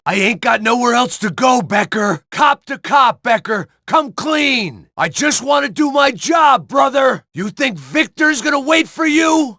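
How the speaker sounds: furious shouting over gunfire; shouting at Becker. Acted. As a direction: bellow it at him, cop to cop.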